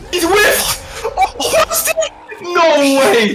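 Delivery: high, loud hype shouting; excited esports caster. Real, not acted. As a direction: yell the clutch save in disbelief. Caster reacting to a clutch save.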